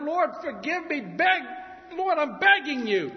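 high, loud begging cry; begging prayer. Pretend. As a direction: plead for forgiveness. Sinner begging God.

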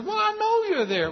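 put-on very high child voice; scared little girl. Little girl answers mother in the dark, high child voice. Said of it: "little girl's a little scared of the dark".

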